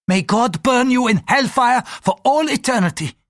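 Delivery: loud, high-pitched damnation; furious priest's outburst; flaring fury. A narrator's reading. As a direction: hurl a curse at the heretic. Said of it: "the priest flared".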